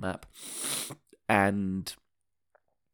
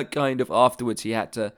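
ordinary talk

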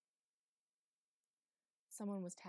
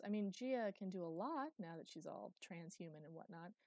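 plain reading voice

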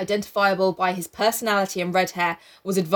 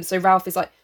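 ordinary talk

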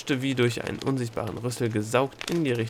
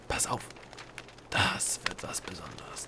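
hushed secretive whisper; 5 semitones lower, 10 dB quieter than usual. A narrator's reading. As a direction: lean in and whisper it. Gnom flüstert verschwörerisch. (verschwörerisch geflüstert)

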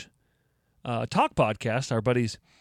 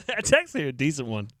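ordinary talk, nothing marked

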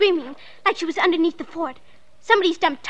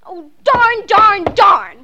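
frustrated; 2 semitones higher, 7 dB louder, much slower than usual. Acted. Girl left to dig alone, frustrated outburst. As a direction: let the frustration burst out louder on each word.

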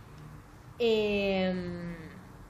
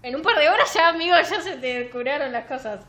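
loud, higher sarcastic quip; sarcastic laughing jab; 6 semitones higher, 8 dB louder than usual. Real, not acted. Host mocking the book's plot to her cohost, sarcastic laugh. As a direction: scoff at the book with a laugh.

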